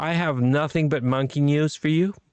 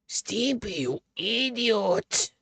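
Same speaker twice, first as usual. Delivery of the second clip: high, slow, put-on cartoon screech; slow, cartoonish insult pitched way up; cartoon character voice; 8 semitones higher, 3 dB quieter, much slower than usual. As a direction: sneer it like a cartoon dog.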